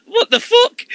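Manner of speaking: very high, loud disbelieving yelp; shocked exclaim. Retold or imitated. Teller retells his shock at the counter guy, high yelp. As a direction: pitch it way up in disbelief. Retold shock in kebab shop.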